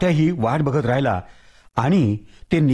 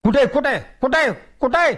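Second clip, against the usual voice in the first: much higher, startled and quick; startled Tatya; 11 semitones higher, slower than usual. Acted. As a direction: ask in a sudden fright. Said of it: "तात्या एकदम दचकला".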